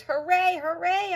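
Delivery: very high, loud cheering; joyful barks. A narrator's reading. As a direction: shout for joy like a happy dog. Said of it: "I bark".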